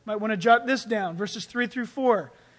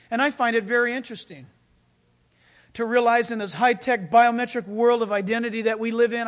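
normal talking voice

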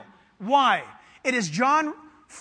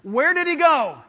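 louder, higher, emphatic call to answer; insistent repeat question; 4 semitones higher, 5 dB louder than usual. Real, not acted. Preacher prompting the church to answer, loud and insistent. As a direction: demand a confident answer from the crowd. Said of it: "act like you know".

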